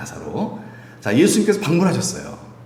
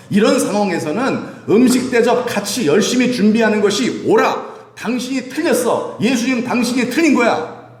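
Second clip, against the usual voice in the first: much higher, louder insistence that he is wrong; indignant accusation; 10 semitones higher, 4 dB louder than usual. Acted. Martha telling Jesus he is wrong, indignant and higher. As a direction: scold him, sure you are right. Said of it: "이 얘기를 하고 있는 거예요".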